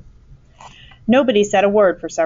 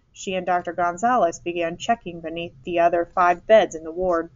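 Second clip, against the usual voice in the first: plain reading voice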